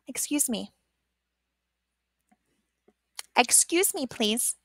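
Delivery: soft, polite little request. Shown as a demonstration. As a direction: ask quietly and politely. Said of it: "I use her to explain that".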